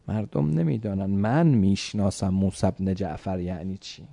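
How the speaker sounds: ordinary delivery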